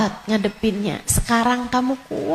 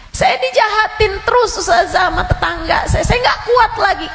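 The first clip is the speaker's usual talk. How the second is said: pitched-up pleading cry; 11 semitones higher, faster than usual. Acted. A bullied congregant to the ustazah, high and wailing. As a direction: wail it to the ustazah.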